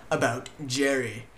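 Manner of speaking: "About Jerry" slow and deliberate; slow repeat. Real, not acted. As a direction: stretch out "About Jerry" at normal pitch. Repeats the topic slowly.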